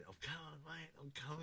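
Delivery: a hint of excited calling out; hurried mate on phone. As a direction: mimic the running caller again. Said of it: "just running".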